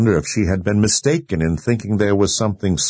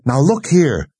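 raised pitch, indignant; heated call; 7 semitones higher, 3 dB louder than usual. A narrator's reading. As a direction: call heatedly through a closed door. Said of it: "Nicky called out heatedly".

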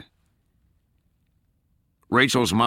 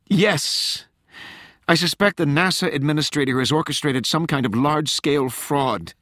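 high, agitated explanation; urgent plea; 9 semitones higher than usual. A narrator's reading. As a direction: press desperately for more time, pitched high. Rachel pleads for a delay.

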